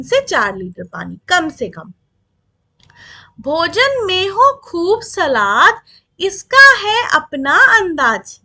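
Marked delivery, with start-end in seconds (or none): 3.4-8.4 slow, louder rhythmic reading of verse; poem recitation. Acted. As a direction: recite the fruit list slowly and clearly to the class. (धीमी, ज़ोरदार लय में कविता पाठ)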